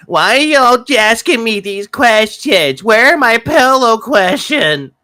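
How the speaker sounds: high, slow whiny complaint; mock the guest's pouty voice; mock indignant voice. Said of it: "he even had that face of".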